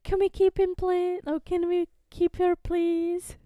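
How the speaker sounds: high, loud mock begging; childlike pleading. Villagers begging to keep the newcomer, hammed-up pleading. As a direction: beg for it high-pitched, like wanting a pet.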